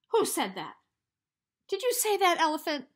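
loud furious demand; angry. Acted. Hippo demanding who insulted him, loud angry. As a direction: bellow at the suspect.

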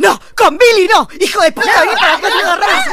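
screaming high and loud, chasing her brother; enraged yelling. Acted. Sister screaming at her brother to come back. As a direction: shriek it like you're lunging across the table. Sibling fight over [name].

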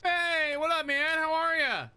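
high, loud friendly call. Real, not acted. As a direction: call out to a friend across the map. Greeting friend over game voice chat.

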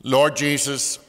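normal talking voice

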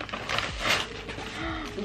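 sharp unvoiced gasp of delight; a quick delighted breath in. Real, not acted. Unboxing, sees the top.

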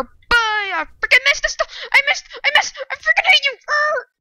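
high, frantic rage yell; screeching gamer meltdown; raging. Real, not acted. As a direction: blow up at the game. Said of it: "I'm actually raging".

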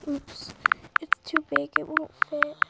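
ordinary delivery

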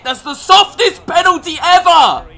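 high, very loud protest at the referee; furious yelling over a bad call; outraged shouting. Real, not acted. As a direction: scream it in real disbelief. Protesting a penalty given against him.

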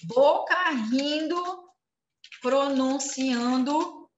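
slow, drawn-out step-by-step instruction; slow demonstration. Shown as a demonstration. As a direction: spell it out slowly for the class. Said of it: "pra vocês lembrarem".

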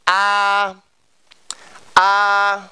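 "a" held long on a steady pitch; slow pronunciation demo of "a"; model vowel. Shown as a demonstration. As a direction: sustain a pure, even "a". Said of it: "Các bạn nghe phát âm của thầy".

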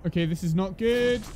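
ordinary delivery

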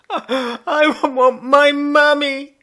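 high, loud, slow wail; a crying child still shouting for mummy; sobbing yell. A narrator's reading. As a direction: howl it through tears. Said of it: "he just went on yelling".

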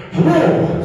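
high, loud announcer-style hello; Howard Finkel. Acted. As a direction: attempt a ring announcer's booming greeting. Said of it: "Howard Finkel impression".

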